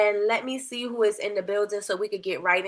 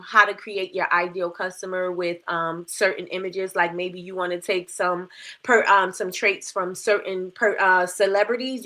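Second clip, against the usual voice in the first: ordinary delivery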